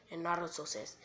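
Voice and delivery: ordinary delivery, nothing marked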